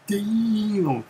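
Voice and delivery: impressed friend. Retold or imitated. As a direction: sound floored by the punch. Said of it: "my friend Reggie was like".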